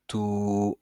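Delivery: "tout" with a long, held vowel. Shown as a demonstration. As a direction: draw out the vowel of "tout" evenly. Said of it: "ça va me faire le son". (« tout » avec voyelle longue tenue)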